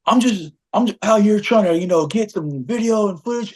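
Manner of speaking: high, mocking version of the cameraman; imitated excuse-making. Journalist explaining himself to the fan, mockingly imitated high excuse. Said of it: "he just tries to say something like".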